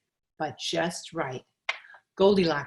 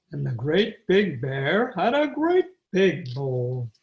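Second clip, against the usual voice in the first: great big voice; 3 semitones lower, 4 dB louder, slower than usual. A narrator's reading. Big bear line, slow, deep.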